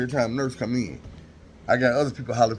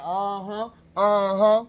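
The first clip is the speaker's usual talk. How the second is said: put-on much higher, knowing older-woman uh-huh; the nurse acknowledging his thanks; 8 semitones higher, much slower than usual. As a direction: answer like you saw it coming.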